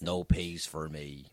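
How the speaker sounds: put-on low, slow Northern Irish accent; threatening, guttural Ulster voice; deep guttural Belfast. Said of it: "You go quite deep, don't you?".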